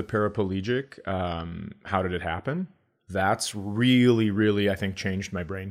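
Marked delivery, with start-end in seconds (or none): none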